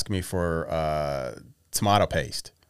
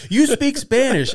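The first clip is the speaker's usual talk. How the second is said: loud, high, slow retold protest; mock outrage; 8 dB louder, slower than usual. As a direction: protest in hammed-up disbelief. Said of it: "it just pisses everybody off".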